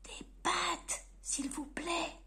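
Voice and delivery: soft timid whisper; shy hushed repeat. Acted. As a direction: repeat the order under the breath. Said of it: "répétait-il".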